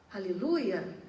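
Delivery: ordinary talk, nothing marked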